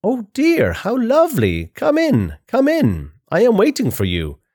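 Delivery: high-pitched, delighted grandma. A narrator's reading. Grandma welcoming her granddaughter in, in a high old-lady voice. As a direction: call out sweetly high, each phrase swooping down. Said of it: "says grandma".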